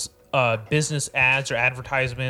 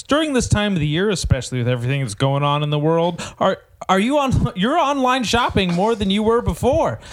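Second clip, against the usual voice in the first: upbeat, fast, higher commercial voice; ad read; 5 semitones higher than usual. Shown as a demonstration. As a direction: sell it like a radio spot. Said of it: "I'll do it professionally".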